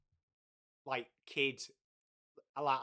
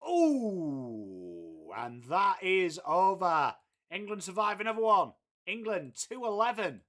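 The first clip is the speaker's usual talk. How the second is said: cricket commentary; 4 dB louder than usual. Acted. Calls the cricket live.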